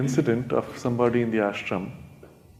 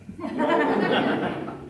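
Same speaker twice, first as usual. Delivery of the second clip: airy delighted chuckle, high; real amused laugh; high breathy laugh. Real, not acted. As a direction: laugh at the joke. Laughs at the beer advice.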